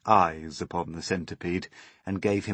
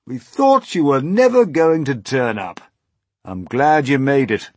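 higher, loud welcoming voice; hearty old grasshopper; 7 semitones higher, 13 dB louder than usual. Acted. Old grasshopper glad James turned up, hearty voice. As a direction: greet him with big loud swoops.

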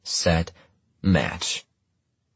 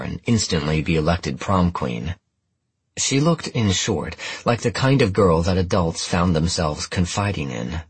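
ordinary delivery, nothing marked

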